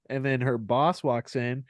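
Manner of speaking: normal talking voice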